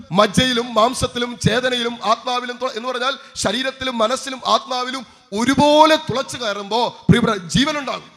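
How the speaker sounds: high, driving pulpit exhortation; forceful preaching. Real, not acted. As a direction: preach it with rising urgency.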